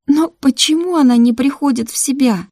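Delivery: high, loud, distressed question; trembling voice. A narrator's reading. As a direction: plead anxiously, pitch and volume up. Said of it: "дрожащим голосом произнесла Мира". (дрожащим голосом, с тревогой)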